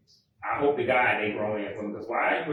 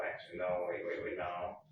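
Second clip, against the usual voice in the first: muttering to self; 6 semitones lower, 10 dB quieter than usual. Real, not acted. Catching own mistake mid-sentence.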